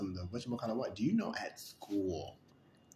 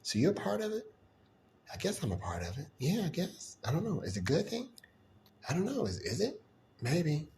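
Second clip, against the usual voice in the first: mock gossip; 8 semitones higher, 3 dB louder than usual. Imitating gossiping neighbors.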